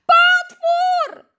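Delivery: very high, loud terrified squeak; frightened squeal. Acted. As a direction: shriek it in sudden fright. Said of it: "pisnęła łyżeczka".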